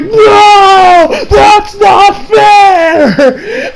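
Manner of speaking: high, loud wail of protest; panicked whiny shout. Retold or imitated. Clone falling off the edge, high panicked yell. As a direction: yell it like a sore loser who got dragged down. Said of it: "the dude's like".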